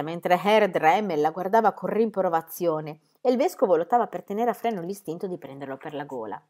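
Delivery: ordinary delivery, nothing marked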